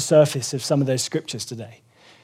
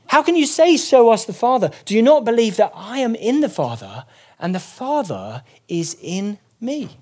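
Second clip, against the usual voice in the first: rising, emphatic rebuke read higher; preaching scripture; 6 semitones higher than usual. Acted. Preacher voicing Jesus rebuking Philip, emphatic and higher. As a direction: press Philip with the question, building.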